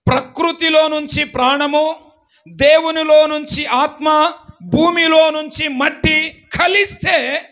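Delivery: loud, high, emphatic preaching; preacher teaching the congregation; preaching at full force. Real, not acted. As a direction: hammer home each phrase.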